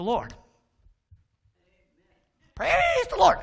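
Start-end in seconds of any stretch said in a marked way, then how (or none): 2.5-3.4 very high, loud squawk; thrown bird voice. Acted. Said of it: "I wouldn't move my mouth".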